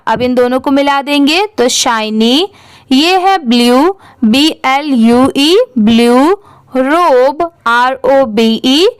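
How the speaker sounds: ordinary delivery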